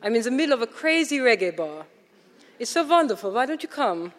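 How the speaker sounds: a German friend calling her out to Harlem; strident German voice. Retold or imitated. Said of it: "a strident German voice said".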